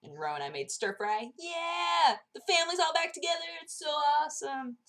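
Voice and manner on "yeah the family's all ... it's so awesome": very high, gleeful squeal; playful cheer. Real, not acted. Vlogger glad family is back together, high cheer. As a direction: celebrate in a high excited voice.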